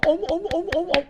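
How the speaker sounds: high barking om sounds like a seal; mock a seal's grunting; imitating a seal. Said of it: "Fok balığı gibi konuşmayın". (fok balığı gibi om sesleri)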